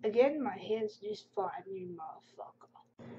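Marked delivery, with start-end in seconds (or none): 1.7-3.1 slow whispered aside; whispered insult. Real, not acted. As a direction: whisper the insult under your breath. Insult muttered after comment.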